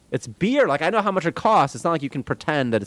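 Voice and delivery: high, loud, fed-up outburst; exasperated rant. Real, not acted. Ranting to chat about overpriced hospital beer, exasperated. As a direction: blurt it out in disbelief.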